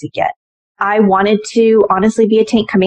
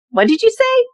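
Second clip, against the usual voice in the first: disbelief. Retold or imitated. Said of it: "And I said".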